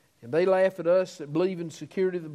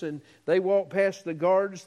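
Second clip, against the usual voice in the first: ordinary talk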